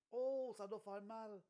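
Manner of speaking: drawn-out pained oh; slow, high wince of sympathy; wincing sympathy. Real, not acted. Reacting to painful fall.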